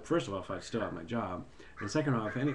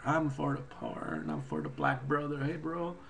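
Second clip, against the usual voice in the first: mock politician; 5 semitones higher than usual. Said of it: "he says".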